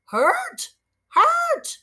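very high squawking parrot repeats. Acted. Parrot Che squawking at the hairy man. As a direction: squawk each word like a bird. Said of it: "the parrot squawked".

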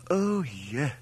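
high, delighted relief; exclaim, pleased to have understood; delighted exclamation. A narrator's reading. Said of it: "exclaimed Mother, delighted at having understood".

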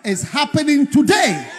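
high, loud preaching shout; prophetic proclamation. Real, not acted. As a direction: declare it triumphantly to the congregation.

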